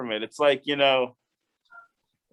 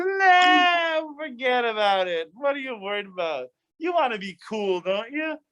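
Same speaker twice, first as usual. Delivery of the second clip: tempting serpent; 9 semitones higher than usual. Acted. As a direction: sweet-talk her like a slick tempter, high and persuasive. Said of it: "And he says".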